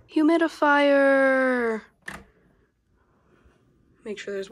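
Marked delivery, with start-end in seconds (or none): 0.1-1.8 high, loud, drawn-out reveal; loud announcement. Real, not acted. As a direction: call it out slowly like a reveal.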